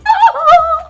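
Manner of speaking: very high-pitched hooting laugh. Acted. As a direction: squeal out a laugh. Reacting to the death threat.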